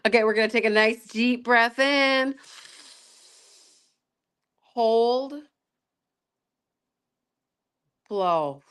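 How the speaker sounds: calm breathing coach voice; guided breathing. Acted. Leads breathing exercise.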